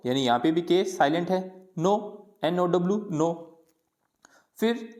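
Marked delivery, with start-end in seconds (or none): none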